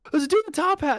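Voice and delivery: eerie roommate voice. Retold or imitated. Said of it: "And then he's like".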